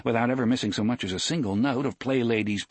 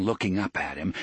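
ordinary talk

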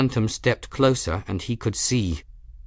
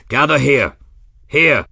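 loud, high repeated shouts; calling out; 5 semitones higher, 7 dB louder than usual. A narrator's reading. Captain calling his crew through dust, shouting. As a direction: keep calling them in, loudly. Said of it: "He kept calling out".

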